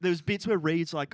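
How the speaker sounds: normal talking voice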